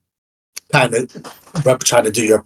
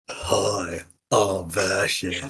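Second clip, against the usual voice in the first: soft, slow, deliberately bad copy of Bastion; mock him badly and quietly; awful impression of Bastion; 4 semitones lower, 9 dB quieter, much slower than usual. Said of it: "that's an awful impression of me".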